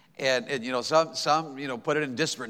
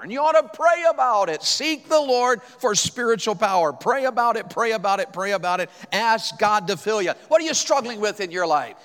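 higher, louder exhortation in bursts; revival preaching; 6 semitones higher, 4 dB louder than usual. Acted. Pastor kicking off revival with church family, preaching at full force. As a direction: urge the church to pray, pushing hard.

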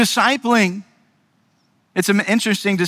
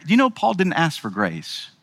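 normal talking voice

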